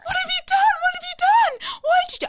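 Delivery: high, loud panicked shouting; hysterical shout. Retold or imitated. Mother shouting at her daughter over the haircut, high and loud. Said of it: "I'm hysterical".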